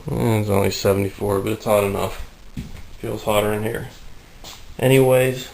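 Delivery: ordinary talk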